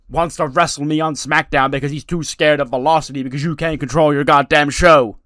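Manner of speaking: loud, high, indignant wrestler rant; angry rant. Retold or imitated. Wrestler yelling at the general manager, loud and indignant. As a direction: shout the grievance at the manager. Wrestler ranting in retold promo.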